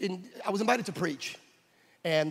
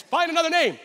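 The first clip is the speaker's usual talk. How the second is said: much higher, loud shouted order; barking order; 12 semitones higher, 8 dB louder than usual. Retold or imitated. Officer shouting at Israel, loud and high. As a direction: bark the order at him. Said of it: "the guy says".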